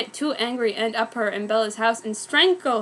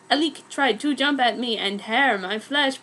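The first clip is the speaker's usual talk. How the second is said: ordinary delivery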